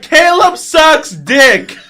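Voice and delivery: slow, loud, high yell; screamed taunt. Retold or imitated. Kid yelling across the playground at a rival. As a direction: drag out the taunt at full volume. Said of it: "and I screamed".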